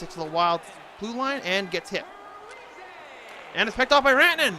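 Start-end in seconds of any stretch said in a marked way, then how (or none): 3.5-4.5 excited call. Acted. Sudden turnover call.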